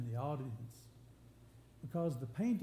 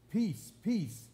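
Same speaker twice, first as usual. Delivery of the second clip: "Peace, peace" with each word punched louder; emphatic repetition; 3 semitones higher, 5 dB louder than usual. Shown as a demonstration. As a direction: say "Peace, peace" as two separate, weighty beats. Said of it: "in order to place emphasis on it".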